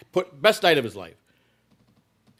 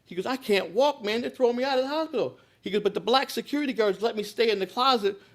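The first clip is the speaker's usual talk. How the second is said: desperate phone call; 10 semitones higher than usual. Retold or imitated. J J calls from the hospital.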